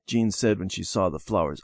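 ordinary delivery, nothing marked

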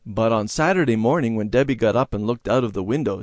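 ordinary delivery

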